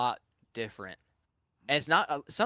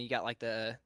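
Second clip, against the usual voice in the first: usual speaking voice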